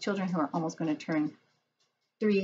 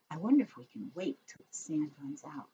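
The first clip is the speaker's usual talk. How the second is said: ordinary delivery, nothing marked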